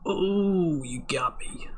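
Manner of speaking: high, hammy defeated anime villain; mock anime voice. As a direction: overact getting beaten.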